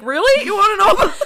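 high, loud, incredulous; surprised disbelief. Retold or imitated. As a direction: act amazed anyone would ask. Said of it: "I was like".

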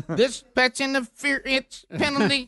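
hammed-up sideline reporter read; Sergio Dip impression. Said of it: "Sergio Dip do the Saint, uh, pass interference".

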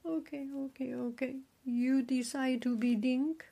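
high, hushed, placating voice; soft giving in. Acted. River god yielding to his daughter, softly placating.

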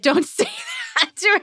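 high laughing "don't say that"; playful whine. Retold or imitated. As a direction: plead half laughing.

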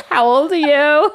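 high, laughing disbelief; half laugh, half cry, incredulous. Retold or imitated. Mom retelling her laughing disbelief at the jealous mother.

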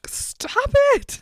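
high-pitched, exasperated mock yell; hammed-up girlfriend at the end of her patience; exasperated outburst. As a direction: snap it out, pitch leaping way up. Acted lovers' quarrel, she snaps.

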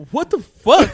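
high, loud shocked shout; shocked yell. Retold or imitated. Kid shocked at the coach's demand, loud and high. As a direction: blurt it out in disbelief. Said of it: "And he was like".

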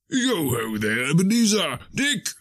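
fat, jovial call climbing high; oily, rich, jovial voice. Acted. Fezziwig calls out to his apprentices, jovial and rich. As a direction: call out comfortably, rich and jolly. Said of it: "comfortable, oily, rich, fat, jovial voice".

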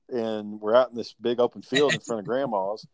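normal talking voice

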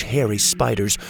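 ordinary delivery

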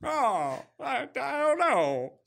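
high, slow stammering impression; Jimmy Stewart. Actor answering a director who wants an accent, stammering impression. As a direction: waver through it as the hesitant actor. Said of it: "could you try an accent for this one".

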